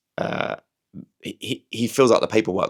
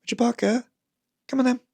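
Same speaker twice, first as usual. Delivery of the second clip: much higher calling voice; animal voice; 13 semitones higher, faster than usual. Shown as a demonstration. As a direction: call the cat up high. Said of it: "you have to do your voice".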